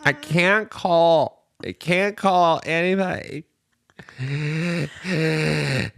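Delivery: put-on high, slow, whiny drunk girl voice; drunk teenage girl impression. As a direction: whine like a drunk teen calling dad. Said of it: "This drunk sixteen-year-old girl impression".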